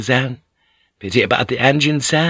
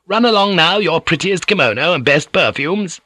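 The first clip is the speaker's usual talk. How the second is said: higher, louder brisk dismissal; shooing; 8 semitones higher, 3 dB louder than usual. Acted. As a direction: shoo the girl off with a bright push. Gyoko shoos Kiku away.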